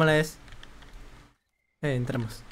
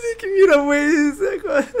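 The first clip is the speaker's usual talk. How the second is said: high laughing through fright; shaky laugh; 9 semitones higher than usual. Real, not acted. Streamer recovering from a scare, laughing. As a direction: laugh it off shakily.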